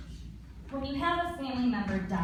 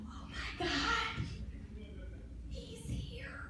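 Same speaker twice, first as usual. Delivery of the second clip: shocked inner thought; 7 semitones higher, 10 dB quieter, slower than usual. Retold or imitated. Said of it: "And I thought".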